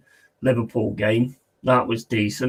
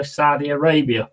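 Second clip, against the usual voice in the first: usual speaking voice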